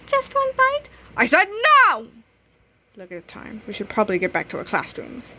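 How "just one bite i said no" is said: coaxing then snapping. Acted. Cookie offer rejected.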